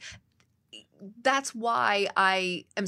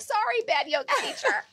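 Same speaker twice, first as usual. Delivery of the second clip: high, playful self-scolding; teasing herself, higher; mock apology. Self-mocking apology for phone.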